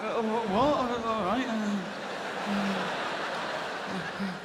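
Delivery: high, hesitant mock builder, trailing off; puzzled builder. Builder called back for an encore, high hesitant mock confusion. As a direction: play a baffled builder unsure why he's called back.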